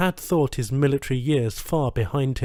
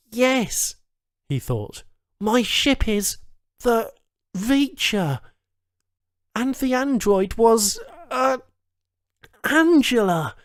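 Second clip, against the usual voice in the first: higher, slow relieved remembering; relieved recall; 10 semitones higher, slower than usual. A narrator's reading. Charles remembering his ship and android, relieved. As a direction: savour each recovered name.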